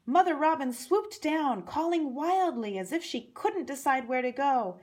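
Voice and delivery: ordinary delivery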